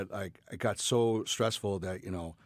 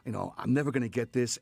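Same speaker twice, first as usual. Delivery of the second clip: usual speaking voice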